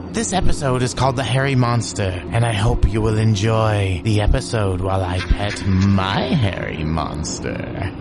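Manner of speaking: usual speaking voice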